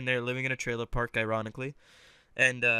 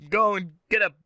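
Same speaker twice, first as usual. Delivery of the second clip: higher-pitched, hammed-up Australian; Australian accent; 4 semitones higher than usual. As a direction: put on a bad Aussie accent. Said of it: "try to do Australian".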